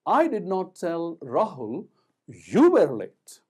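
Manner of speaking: slow lead-in, pause, then very loud "you"; stress on YOU. Shown as a demonstration. Teacher laying emphasis on another word, stress on "you". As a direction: pause before "you" and hit it hard. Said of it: "laying emphasis on "you".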